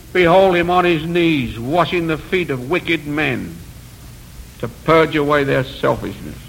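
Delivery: usual speaking voice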